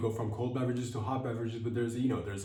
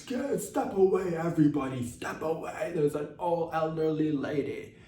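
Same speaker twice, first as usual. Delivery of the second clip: commanding call; 6 semitones higher than usual. Retold or imitated. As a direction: clear the way like a self-important bodyguard. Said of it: "I said".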